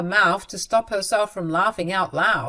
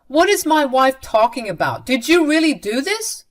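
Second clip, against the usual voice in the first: raised, indignant questioning; higher and louder, acted outrage; angry confrontation; 6 semitones higher, 6 dB louder than usual. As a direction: demand an explanation from your mother. Son angrily confronts his mother.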